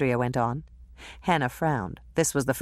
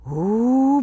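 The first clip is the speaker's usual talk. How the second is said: higher, louder dismayed ooh; 8 semitones higher, 5 dB louder than usual. Acted. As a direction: react to bad news. Hannah dismayed.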